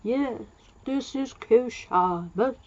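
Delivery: put-on slow, drawn-out old man's reply; old guy. Old guy answering the Doctor's call, slow old-man voice. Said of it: "this old guy said".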